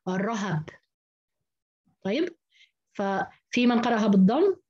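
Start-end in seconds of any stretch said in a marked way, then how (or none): 0.1-0.6 الرهب as ar-rahab, two a vowels; open ra and ha. Shown as a demonstration. Teacher showing remaining readers' form, both letters open. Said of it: "بفتح الراء والهاء".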